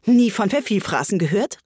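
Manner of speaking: amazed, rising exclamation; full of amazement. Acted. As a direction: throw it back in disbelief. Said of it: "voller Verwunderung".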